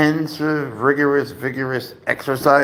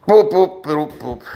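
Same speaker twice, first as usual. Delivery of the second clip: playful boops; 4 semitones higher, 6 dB louder, slower than usual. Real, not acted. Booping the cat's nose.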